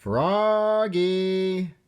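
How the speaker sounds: high, drawn-out call of "Froggy"; mother calling out. Acted. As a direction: call him back again.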